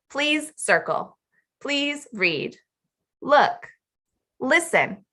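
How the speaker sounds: "Please circle", "Look", "Listen" as slow falling commands; command intonation. Shown as a demonstration. Teacher models language at students' level, falling commands. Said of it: "not with commands and instructions".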